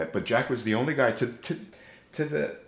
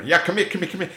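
high, loud, repeated beckoning; mimicked insistent summons; imitated drunken badgering; 10 semitones higher, 6 dB louder, much faster than usual. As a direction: pester her in a put-on tipsy voice. Drunk man badgering his sister-in-law.

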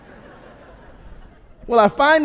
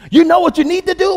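loud, raised-pitch shouting as dad; imitated dad yelling; 9 semitones higher, 8 dB louder, faster than usual. Country dad breaks the silence rule during football, yelling. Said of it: "he starts yelling at us".

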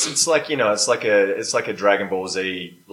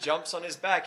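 normal talking voice

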